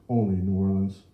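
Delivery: ordinary delivery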